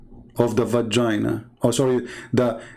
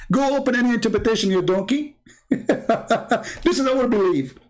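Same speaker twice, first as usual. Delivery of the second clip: mocking laugh; 10 semitones higher than usual. Real, not acted.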